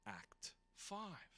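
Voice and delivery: normal talking voice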